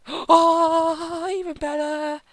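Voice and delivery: high, excited gasp of joy; thrilled at the loot; delighted gasp. Real, not acted.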